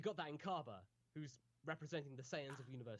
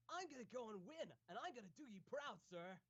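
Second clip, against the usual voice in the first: put-on eager young shonen hero, pitched up; earnest determined anime hero; 5 semitones higher, 6 dB quieter than usual. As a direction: play the go-getter anime hero. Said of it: "it's the typical, um, anime hero voice".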